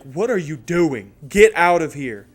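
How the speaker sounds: slow loud question, then a yelled order; exasperated homeowner. Retold or imitated. Dude catching the kid at the next house, exasperated shout. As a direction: shout the intruder away.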